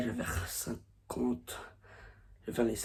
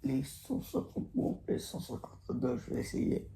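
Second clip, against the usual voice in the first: whispering; 9 dB quieter than usual. Real, not acted. Early morning, quiet at 7am.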